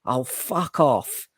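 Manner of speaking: slow weary oh fuck off; drawn-out oh fuck off. Retold or imitated. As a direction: let it drag out, fed up. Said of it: "and I went".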